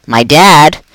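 loud call from the back; shouted out. A narrator's reading. A pupil calling out in class, loud. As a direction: blurt it out loud. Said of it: "a voice shouted out".

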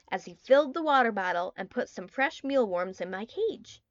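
usual speaking voice

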